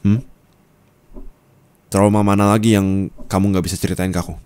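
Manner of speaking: normal talking voice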